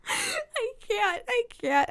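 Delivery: high, helpless giggly protest; real laughter squeezing the words up high; giggling fit. Real, not acted. Reader admitting she's such a little child, giggling fit. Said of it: "I'm such a little child".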